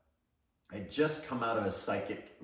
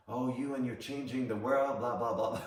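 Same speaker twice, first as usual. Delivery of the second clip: higher, louder mocking imitation; mock scoffing; 6 semitones higher, 5 dB louder than usual. Mother scoffing at her son's big plan, mocking imitation. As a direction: parrot it back in a mocking, raised voice.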